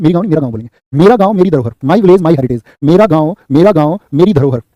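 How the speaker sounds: usual speaking voice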